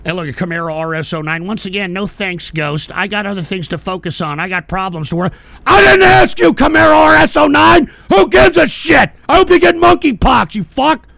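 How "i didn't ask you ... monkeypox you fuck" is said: much higher and louder, furious; angry yell. Real, not acted. Streamer yelling at a chatter who gave money, furious. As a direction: explode at the donor.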